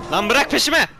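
loud, harried protest; exasperated shout. Real, not acted. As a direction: shout off a pursuer. Shouting at pursuing game enemy.

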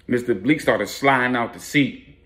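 usual speaking voice

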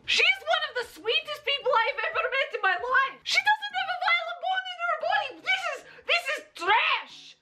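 hammed-up offended squeal; mock outrage. Real, not acted. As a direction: play up the shocked indignation. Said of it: "Pilaf's getting heated again".

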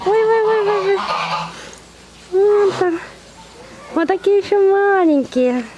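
high, excited coaxing call to the cubs. Real, not acted. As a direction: squeal with joy at the little cubs. Spots the cubs coming out.

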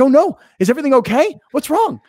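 high, fast, worried voice; mock worried. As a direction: rattle off the worry up high. Acting his worried reply.

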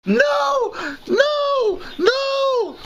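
shrill silly squeal of no; high squealing shouts. Pretend. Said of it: "Why am I saying that".

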